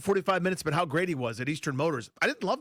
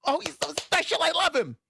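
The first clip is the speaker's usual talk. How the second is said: high, far-off-sounding fawning fan gush; hammed-up adoring fan voice; mock gushing fan; 10 semitones higher than usual. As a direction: gush like a starstruck fan, much higher. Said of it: "you no longer get to be".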